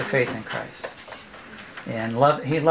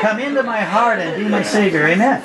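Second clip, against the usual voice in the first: laughing while quoting; 8 semitones higher, 5 dB louder than usual. Real, not acted. Quoting son's prayer while laughing.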